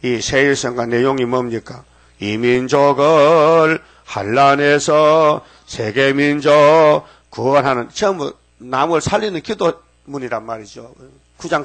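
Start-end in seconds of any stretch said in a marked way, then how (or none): 2.2-7.9 drawn-out, louder chanted quote; slow hymn recitation. Acted. Preacher intoning a hymn line slowly to the congregation.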